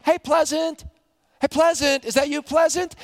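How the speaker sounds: high, bright mock call across town; mock cheerful greeting. Townsfolk hailing Naomi, in a mock cheerful call. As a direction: call out cheerily to an old friend. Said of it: "if you cry out to me".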